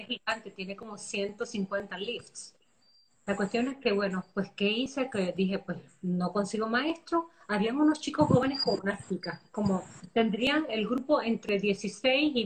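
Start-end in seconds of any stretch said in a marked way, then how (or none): none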